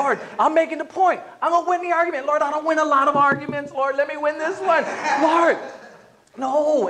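high-pitched acted whine to God; mock stubborn pleading. Husband begging the Lord to win an argument, mock stubborn protest.

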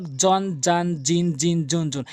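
each word begun with a hard Bengali j; জন, জান, জিন, জুন with j instead of tongue-on-teeth sound; wrong j sound. Retold or imitated. Teacher mimics learners' mistake, plain hard j. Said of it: "একদম ভুল".

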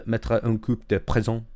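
slow, careful French with an English accent; clumsy French accent; attempted French. Shown as a demonstration. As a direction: sound out the French phrase haltingly. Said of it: "In French".

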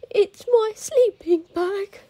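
high, slow, tearful fox; upset fox voice. A narrator's reading. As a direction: whimper it like a sad little fox.

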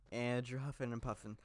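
a slightly sick, rough voice. Real, not acted. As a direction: talk while coming down with a cold. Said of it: "Sorry if I sound a little weird".

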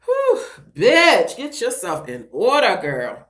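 loud, high pull-yourself-together burst; self-scolding. Real, not acted. Crying vlogger orders herself to get in order, self-scolding. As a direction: snap yourself out of tears, loudly.

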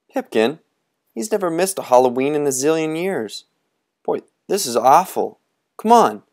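high, quick, anxious; worried. Acted. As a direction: fret about the missing friend. Worried boys.

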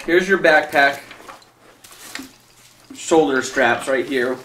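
normal talking voice

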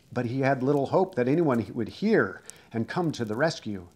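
usual speaking voice

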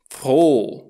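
"froh" with a loud long o, silent h; long vowel. Shown as a demonstration. Teacher shows H lengthening the vowel, "froh" with drawn-out o. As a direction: stretch "froh" into "frooo". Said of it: "această vocală este lungă".

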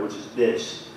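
ordinary talk

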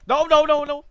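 loud, high, rapid-fire refusal; real alarm, shouted; panicked. Real, not acted. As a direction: cry out in panic. Panicking in the game.

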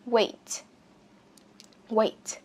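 "Weight" with a long English A vowel and popped T. Shown as a demonstration. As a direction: say the EI in "Weight" like the letter A. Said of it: "chúng ta phát âm là A".

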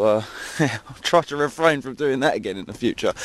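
ordinary delivery

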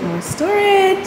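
high, drawn-out punchline; mock excitement. Vlogger showing yet another cabinet, comically thrilled. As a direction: sell the joke with a squeal.

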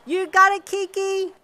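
enthusiastic high-pitched yell; shouting back. A narrator's reading. Competitor answering her friend in the stands, shouting enthusiastically. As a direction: holler back with everything you have. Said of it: "Jinsei shouted back at her".

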